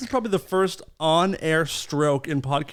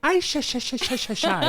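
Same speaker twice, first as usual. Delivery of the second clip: nervous stutter; 5 semitones higher than usual. Retold or imitated. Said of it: "Like you're a nervous".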